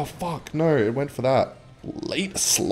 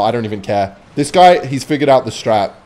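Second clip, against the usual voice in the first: ordinary talk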